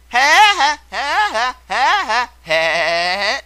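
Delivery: high, loud cartoon woodpecker cackle; Woody Woodpecker laugh. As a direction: rattle off the famous woodpecker laugh. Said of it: "I'll give you a clue".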